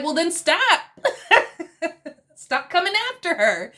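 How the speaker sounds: laughing retort. Retold or imitated. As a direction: retell the comeback while cracking up. Laughing while retelling Joe's retort.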